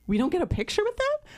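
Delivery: high, quick protest of disbelief; incredulous question. Retold or imitated. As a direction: ask it high and fast, taken aback. Retold dismayed question to staff.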